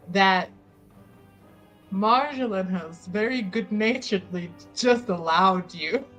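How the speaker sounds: ordinary talk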